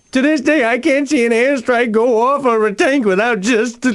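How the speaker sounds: comic breakdown over a lost toy; breaking down. Acted. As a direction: overcome with emotion, voice cracking. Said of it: "get a hold of yourself".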